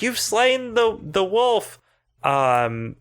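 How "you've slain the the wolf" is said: cheering townsfolk. Retold or imitated. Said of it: "everyone's like".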